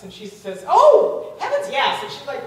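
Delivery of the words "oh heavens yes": high, loud burst of generosity; emphatic agreement. Retold or imitated. Woman asked by her friend for gas money, emphatic agreement. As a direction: agree wholeheartedly, leaping on the first word.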